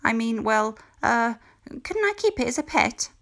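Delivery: voz muy aguda y suplicante; nervous plea. A narrator's reading. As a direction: ruega con nervios. Mildred pleads nervously.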